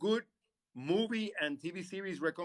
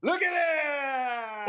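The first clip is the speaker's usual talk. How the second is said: loud, high, drawn-out call; excited shout; 9 semitones higher, 6 dB louder, much slower than usual. Real, not acted. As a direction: shout it out with delight, stretching it. Showing off girlfriend on camera.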